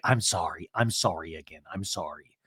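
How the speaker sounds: small retold sorry, sinking away; meek apology. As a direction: drop low and trail off apologetically. Mimicking sheepish apology in reaction box.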